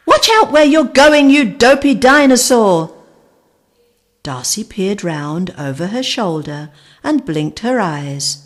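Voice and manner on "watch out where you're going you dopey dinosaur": angry yell. Acted. Raptor knocked into lake.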